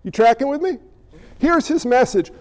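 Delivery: ordinary talk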